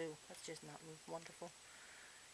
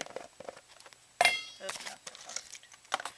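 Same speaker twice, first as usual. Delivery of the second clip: whispered; much slower than usual. Real, not acted. Bird's noises, whispered aside.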